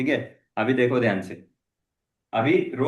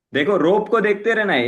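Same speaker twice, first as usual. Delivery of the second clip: coach calling out; 5 semitones higher, 6 dB louder, much faster than usual. Real, not acted.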